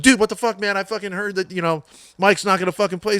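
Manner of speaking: high, loud mock yell down the phone; half-acted yell of an angry friend; retold yelling. Best buddy on the phone yelling at him, mock yell. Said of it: "he was yelling".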